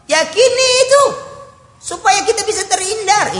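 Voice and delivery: high, loud cry of conviction; rising preacher's exhortation; emphatic urging. Real, not acted. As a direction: drive the point home with a shout. Preacher urging congregation to believe.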